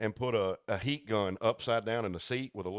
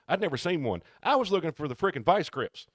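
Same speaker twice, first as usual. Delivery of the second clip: normal talking voice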